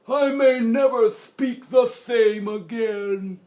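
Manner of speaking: moaning grandpa. Acted. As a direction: wail it like a desperate grandpa.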